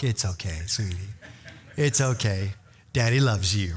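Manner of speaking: low, soft cooing reassurance; tender pet talk. Retold or imitated. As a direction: soothe a little dog like a doting daddy. Retold soothing of the dog.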